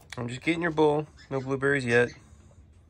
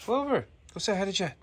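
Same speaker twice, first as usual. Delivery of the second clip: much higher, fast sing-song urging; high pet talk; 10 semitones higher, faster than usual. Real, not acted. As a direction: chirp at the dog to go. Urging the dog.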